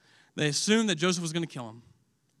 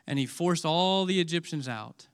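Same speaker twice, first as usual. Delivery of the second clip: usual speaking voice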